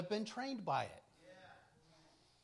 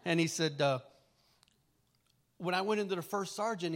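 ordinary talk

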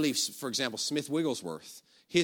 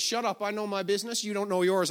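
raised-pitch retold retort; sharp rebuke; 6 semitones higher than usual. Healer answering the screaming lady, rebuking her. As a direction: put the heckler in their place.

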